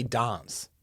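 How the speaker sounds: posh Adelaide accent on "dance"; refined Adelaide accent. Shown as a demonstration. Mocking how Adelaide people say a word, refined Australian accent. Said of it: "really refined bullshit Australian accent".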